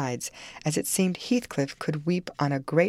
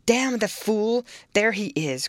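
higher, heated outburst; angry cry; 6 semitones higher, 3 dB louder than usual. A narrator's reading. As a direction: cry out in exasperation. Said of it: "cried Heathcliff".

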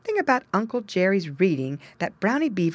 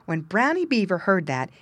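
ordinary delivery, nothing marked

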